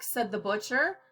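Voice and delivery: usual speaking voice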